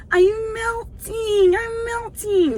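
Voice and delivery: high, slow mock whimper; hammed-up melting cry. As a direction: melt like a dramatic witch. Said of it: "melting heart".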